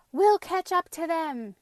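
high, loud determined declaration; declaring. A narrator's reading. Boy running after the vegetables, loud declaration. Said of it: "declared Anton".

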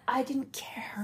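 slow whisper, almost no voice; whispered confession. Real, not acted. Whispering to a friend that she didn't care. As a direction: breathe it out like a secret.

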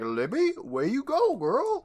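very high squeaky questioning lilt; mock calling out. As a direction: play a puzzled boy searching, voice leaping up. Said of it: "and he's like".

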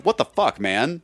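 sudden high burst of disbelief; a sharp high squeak of indignation; stunned outrage. Retold or imitated. Outraged inner reaction, big-timed.

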